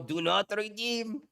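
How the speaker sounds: slow, drawn-out imitated Indian accent; mock Indian caller, stretching the words. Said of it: "the Indian would sound more compelling".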